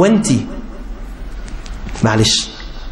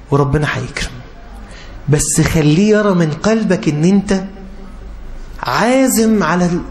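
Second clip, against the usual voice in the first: usual speaking voice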